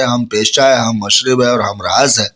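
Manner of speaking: ordinary delivery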